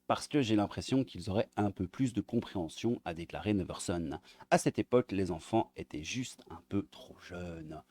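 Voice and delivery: ordinary delivery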